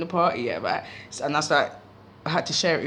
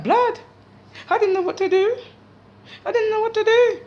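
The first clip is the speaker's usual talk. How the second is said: panicked plea; 13 semitones higher, 4 dB louder than usual. Retold or imitated. Pleading silently with brother.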